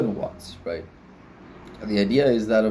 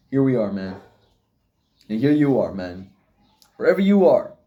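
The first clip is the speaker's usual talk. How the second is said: usual speaking voice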